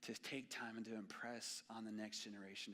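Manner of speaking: ordinary talk, nothing marked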